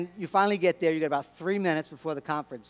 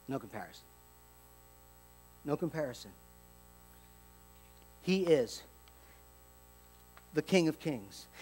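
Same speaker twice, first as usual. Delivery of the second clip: hushed awe; 5 semitones lower, 8 dB quieter than usual. Real, not acted. Preacher to congregation, hushed reverent declaration. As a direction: let it settle in a hush.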